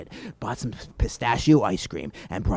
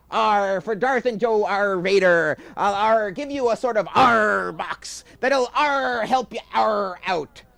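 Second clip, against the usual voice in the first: high, loud hearty pirate growl; 6 dB louder than usual. Acted. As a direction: ham up the old pirate. Pirate voice, arr-ing.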